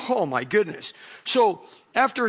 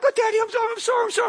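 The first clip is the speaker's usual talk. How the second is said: frantic daughter; 3 dB louder, much faster than usual. Retold or imitated.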